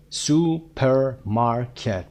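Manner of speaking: "Supermarket" broken into su, per, mar, ket; very slow, separated beats in "Supermarket"; syllable by syllable. Shown as a demonstration. Teacher counting syllables, "Supermarket" split into four beats. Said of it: "ببینید چند هجایی هست".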